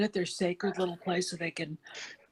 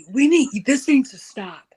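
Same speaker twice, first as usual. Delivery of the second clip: louder and higher, a demand to stop; forceful demand; 4 semitones higher, 9 dB louder than usual. Retold or imitated. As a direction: call out firmly as if addressing the world. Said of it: "kinda say".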